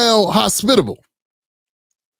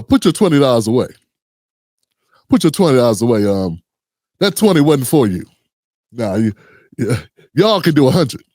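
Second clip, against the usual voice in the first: lower, bossy mob-boss talk; lightly voiced mob boss demanding money; gangster pastor; 8 semitones lower than usual. Pastor telling the congregation to pay more, lower and bossy. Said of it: "His exact words was".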